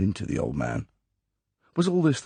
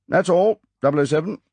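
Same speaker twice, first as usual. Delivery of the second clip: firm, closing the matter, louder and higher; final dismissal; 13 semitones higher, 6 dB louder than usual. A narrator's reading. M dismissing Bond without looking up, final and firm. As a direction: shut the conversation down flat. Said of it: "The tone of voice was final".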